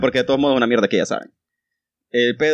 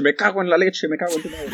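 mock Spain Spanish; 6 semitones higher than usual. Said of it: "doblado al español de España".

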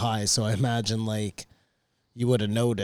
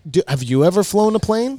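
heated challenge; 7 semitones higher, 5 dB louder than usual. Real, not acted. Heated argument about planes.

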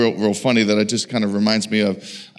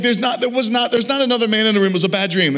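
high, insistent imitated wife; mock wife's high protest; exasperated protest; 8 semitones higher, faster than usual. Wife protesting after waking.

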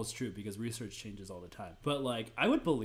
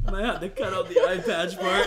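laughing; 5 semitones higher, 8 dB louder than usual. Real, not acted. Laughing joke.

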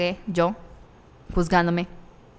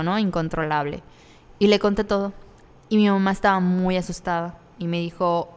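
ordinary talk, nothing marked

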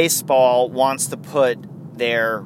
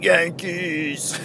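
higher, put-on holler without volume; mock heckle; 4 semitones higher than usual. Fan heckling a Mets hat, mock holler. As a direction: fake a silly shout, then crack up.

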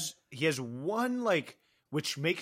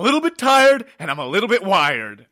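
loud, raised-pitch movie freak-out; freak-out yell; 6 semitones higher, 14 dB louder than usual. Retold or imitated. Fan acting out the star's one freak-out, yelling. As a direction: go full on, yelling it like an unhinged star. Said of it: "moviegoers are expecting him to yell at some point".

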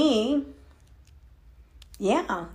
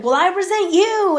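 raised, higher retort thrown back at him; retorting resentfully; 8 semitones higher, 5 dB louder than usual. Retold or imitated. Wife fires back at her husband mid-argument, louder and higher.

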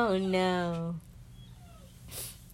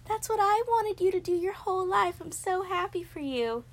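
beam at him, way up high; a high, gushing joy for her nephew; gushing happy voice; 12 semitones higher than usual. Retold or imitated. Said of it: "and I'm like".

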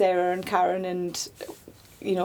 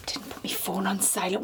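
whispering; 7 dB quieter than usual. Real, not acted. Phone goes off mid-recording.

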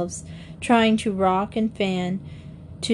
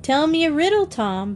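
put-on high, pleading little-boy voice; wheedling high plea; begging child; 7 semitones higher than usual. A narrator's reading. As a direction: beg like a small child, pitch up. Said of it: "Bobby begged".

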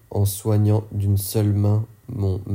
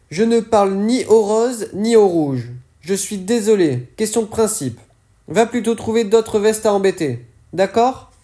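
Lys, higher and louder, bitter refusal; higher, bitter voice of Lys turning her away; character voice, higher; 10 semitones higher, 4 dB louder than usual. Acted.

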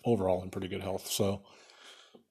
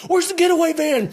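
much higher and louder frantic shout; panicked yell; 9 dB louder than usual. Pretend. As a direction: yell for the missing getaway driver. Imagined bank robbery gone wrong.

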